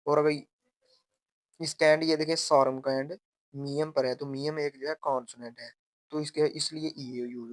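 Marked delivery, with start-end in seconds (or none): none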